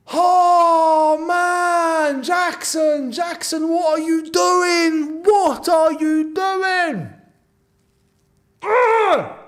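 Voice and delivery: high, slow, despairing yells at the player; exasperated shouting. Real, not acted. Fan berating Jackson after the disallowed goal, despairing shouts. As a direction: plead in frustration, drawing it out.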